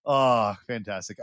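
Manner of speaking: ordinary talk, nothing marked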